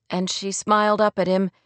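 plain reading voice

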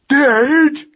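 high, loud drawn-out wail; agonised cry. Acted. Young mouse hears parents are dead, agonised wail. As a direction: wail the word in agony. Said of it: "cried Magnus in an agony".

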